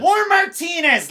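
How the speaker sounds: loud, high, drawn-out shout; shouting capitalized words. Pretend. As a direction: yell it out like a fan's all-caps chat message. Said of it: "so I have to shout it".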